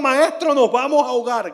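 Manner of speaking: high, loud, urgent shout; shouted cry for help. A narrator's reading. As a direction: cry out to the master as if drowning.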